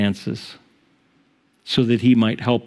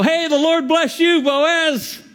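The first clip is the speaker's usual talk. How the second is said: calling back; slower than usual. Acted. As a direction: shout the blessing back across the field. Workers call back to Boaz.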